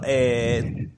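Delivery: ordinary talk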